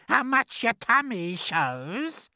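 high, slow taunt; crying out. A narrator's reading. Aunt Spiker mocking her sister's tummy, crying out. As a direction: draw the jibe out high. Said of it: "Aunt Spiker cried".

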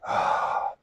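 long unvoiced exhale; sigh of release. Shown as a demonstration. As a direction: breathe out a soft, letting-go "ah". Demonstrates a releasing out-breath.

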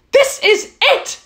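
high, joyful mouse cry; excited cry. Acted. Mouse finding the castle, crying out with joy. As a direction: shout it out with delight. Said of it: "cried the mouse".

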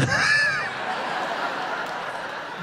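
high, breathy villain cackle; evil cackle. Acted. Comic after the red lightsaber joke, evil cackle. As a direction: cackle like a Sith lord. Dark side punchline.

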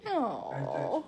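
ordinary delivery, nothing marked

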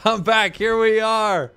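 loud, high and drawn out; triumphant hype. Real, not acted. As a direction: announce it like a champion returning. Triumphant return announcement.

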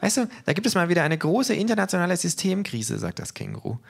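the kangaroo gossiping at the book award. A narrator's reading. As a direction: tell it gleefully as the cheeky kangaroo. Kangaroo voice in reading.

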